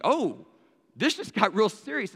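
mildly hammed-up "uh-oh" reaction; dawning alarm. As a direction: act out it suddenly sinking in. Sudden realization in retold story.

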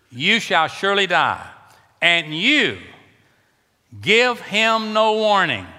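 loud, high, slow scripture preaching; full-force revival preaching. Acted. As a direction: thunder God's warning from the pulpit, word by word.

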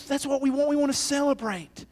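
ordinary talk, nothing marked

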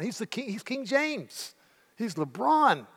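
rising, marvelling at King James; awestruck. Real, not acted. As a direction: pitch jumps up high in awe. Awestruck naming of LeBron.

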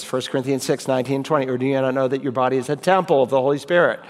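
rattling the verse off quickly; fast recital. Shown as a demonstration. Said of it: "Add another phrase".

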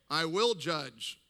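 usual speaking voice

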